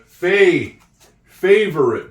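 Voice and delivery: "favorite" with the A stressed and loud; sounding out words. Shown as a demonstration. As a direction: lean on the A in "favorite". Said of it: "Do you hear the A?".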